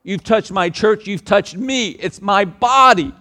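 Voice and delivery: loud, high, slow preaching; fervent sermon climax, loud and slow; preaching at full force. Acted. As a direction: proclaim it to the whole church.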